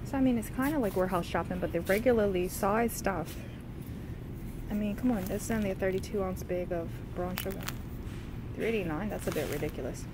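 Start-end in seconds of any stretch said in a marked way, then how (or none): none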